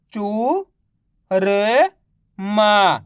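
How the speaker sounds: long gaps between drawn-out चू, र and मा; syllable by syllable. Shown as a demonstration. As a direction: sound out चू, र, मा one at a time. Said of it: "तो मिलके बन जाएगा". (चू, र, मा को अलग-अलग खींचकर)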